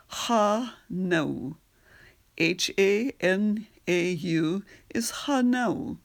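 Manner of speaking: ordinary delivery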